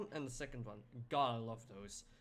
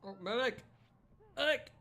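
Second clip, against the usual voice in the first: shaky stammering panic; 10 semitones higher, 7 dB louder than usual. Real, not acted. Calling for medic in game.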